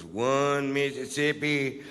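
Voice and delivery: laboriously slow mock count; slow, drawn-out imitation of counting. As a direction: drag each count out like a slow-witted cop. Acts out cop counting money slowly.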